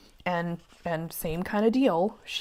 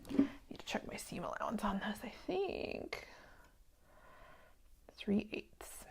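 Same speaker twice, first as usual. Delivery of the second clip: soft whisper to herself, much softer; whispered aside; 2 semitones higher, 13 dB quieter than usual. Real, not acted. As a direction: think aloud in a whisper over the seam.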